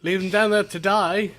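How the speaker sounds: a bad stab at an Australian accent; failed Australian accent. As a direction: try Australian on "Leave them down there to die?". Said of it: "supposed to be Australian".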